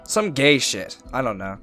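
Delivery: normal talking voice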